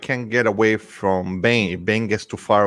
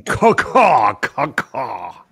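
bird call; 2 semitones higher, 4 dB louder, much slower than usual. Retold or imitated. Echoes the bird signal.

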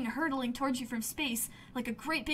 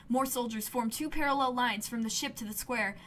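usual speaking voice

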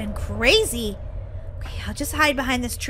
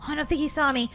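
soft, low aside; hushed relief; 4 semitones lower, 9 dB quieter, much faster than usual. Pretend. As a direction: say it under cover, very quietly. Hiding from wolf.

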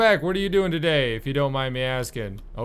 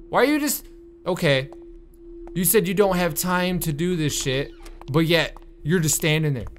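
ordinary talk

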